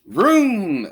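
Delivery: high, loud motor vroom; playful engine noise. Acted. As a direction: rev it like a motorcycle.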